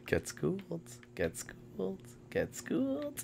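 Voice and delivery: breathy whispered taunt. Real, not acted. Taunting the seeker.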